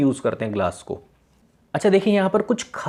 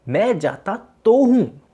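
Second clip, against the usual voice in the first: "मैं जाता तो हूँ" said higher, louder and slow, leaning on "तो हूँ"; emphatic stress; 8 semitones higher, 4 dB louder, slower than usual. Shown as a demonstration. Teacher showing students a sentence said with emphasis, higher and louder. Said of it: "तो sentence पे जोर".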